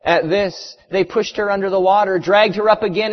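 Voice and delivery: ordinary talk, nothing marked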